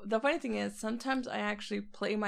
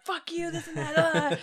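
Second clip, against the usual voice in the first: higher, drawn-out imitation of ranting; mock angry rant; 8 semitones higher, 3 dB louder than usual. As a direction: imitate someone losing their temper. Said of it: "people that gets mad and they're just like".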